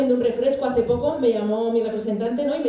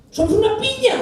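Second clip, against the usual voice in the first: eager answer; 9 semitones higher, 5 dB louder than usual. Retold or imitated. Eager promise to the queen.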